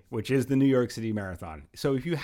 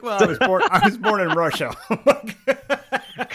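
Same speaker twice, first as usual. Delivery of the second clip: laughing; 7 semitones higher, 8 dB louder, slower than usual. Retold or imitated. Retelling barber's surprising answer.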